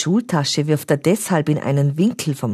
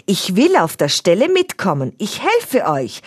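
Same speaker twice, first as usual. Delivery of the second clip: determined voice; 6 semitones higher, 3 dB louder than usual. A narrator's reading. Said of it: "mit bestimmter Stimme".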